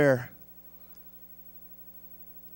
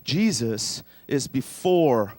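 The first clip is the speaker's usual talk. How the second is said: slow recitation; 3 semitones lower, much slower than usual. Shown as a demonstration. Slow memory verse drill.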